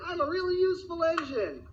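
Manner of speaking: little engine announcing himself brightly; cheery little engine. Acted. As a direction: chirp it like a cheerful toy train. Chipper tank engine speaks.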